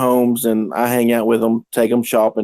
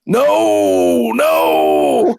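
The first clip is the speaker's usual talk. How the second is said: low gruff shouted no; stubborn man refusing the shower, low gruff shout; gruff refusal; 10 semitones lower than usual. Retold or imitated. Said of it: "he's like".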